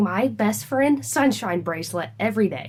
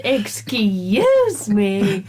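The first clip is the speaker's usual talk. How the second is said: mock offended; 5 dB louder, much slower than usual. Teasing son about refusing bracelet.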